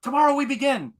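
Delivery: high, grave mock master line; Pai Mei voice. Pai Mei ending the test, high solemn mock voice. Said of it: "And he goes".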